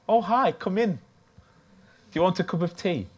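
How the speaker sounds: a lifted, bright-pitched neighbour at the door; welcoming neighbour. Retold or imitated. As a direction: greet her like a friendly mum from school.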